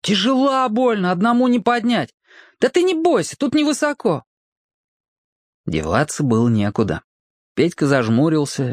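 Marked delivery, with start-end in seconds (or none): 0.0-2.1 put-on high boyish urging; boy hurrying. A narrator's reading. Boy answers from below. 2.6-4.2 put-on high boyish urging; boy hurrying. A narrator's reading. Boy reassures friend.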